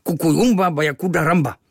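loud, high-pitched scolding in a made-up tongue; grumpy foreign protest. A narrator's reading. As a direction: scold your friend loudly. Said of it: "mračil se Kvíkvék". (mrzuté, hlasité hubování)

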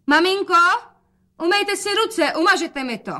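put-on a fussy girl fretting over her dress; young daughter's voice.

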